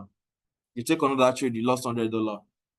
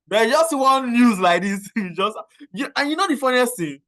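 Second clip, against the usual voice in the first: laughing; 8 semitones higher, 4 dB louder than usual. Real, not acted. Giggling build-up to punchline.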